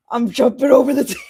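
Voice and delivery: fast, high and loud; giddy. Real, not acted. Player eager to leap at the stranger, fast and high. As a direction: blurt it out in a rush.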